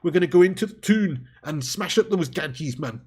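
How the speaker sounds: hammed-up Geordie banter, half-laughing at the attempt; attempted Geordie accent. To the viewers, a botched Geordie accent. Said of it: "I can't do it, Geordie accent".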